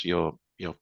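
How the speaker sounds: ordinary talk, nothing marked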